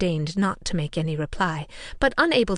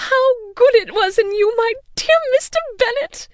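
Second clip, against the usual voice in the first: much higher, louder gushing mother; joyful rapture; 8 dB louder than usual. A narrator's reading. Mother thanking Mr Bennet, high joyful gushing. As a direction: gush with delight at him. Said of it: "the first tumult of joy".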